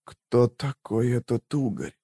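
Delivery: slow, soft, failing voice; weak, halting near-whisper; dying whisper. A narrator's reading. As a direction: labour out the question faintly. Dying woman whispers her last question.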